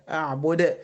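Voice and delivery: أعبد with the final د bounced; qalqala. Shown as a demonstration. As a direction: release the د of أعبد with a small echo. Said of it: "هي دي القلقلة". (قلقلة دال أعبد)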